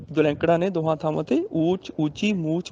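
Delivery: usual speaking voice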